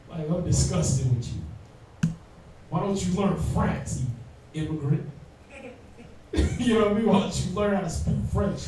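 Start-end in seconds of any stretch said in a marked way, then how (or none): 0.1-5.1 slow, sneering mock disdain; mock disgust. Imitating a snobbish French waiter to an American tourist, slow and sneering. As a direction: drag it out like looking down on someone.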